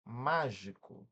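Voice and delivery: mágico said slowly, leaning on MÁ; stressed first syllable. Shown as a demonstration. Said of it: "Por exemplo, a gente tem aqui". ("mágico" com o MÁ alongado)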